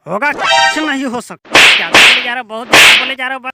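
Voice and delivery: loud, heated comeback; angry retort. Acted. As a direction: raise your voice and tell them off. Acted quarrel, snapping back at the other. (गुस्से में ऊँची आवाज़)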